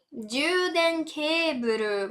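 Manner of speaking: juden keburu spelled out very slowly; teacher's slow model of juden keburu; slow demo. Shown as a demonstration. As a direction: draw out each syllable for the learner. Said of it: "Uma palavra um pouquinho mais longa".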